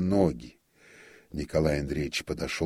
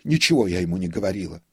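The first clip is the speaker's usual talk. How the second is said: fast, louder indignant retort; flaring up; 4 semitones higher, 7 dB louder, much faster than usual. A narrator's reading. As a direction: snap back quickly, flushed with indignation. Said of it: "вспыхнула Анна Семёновна". (быстро и громче, вспыхнув от возмущения)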